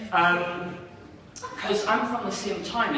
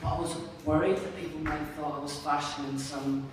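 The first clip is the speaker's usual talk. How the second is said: usual speaking voice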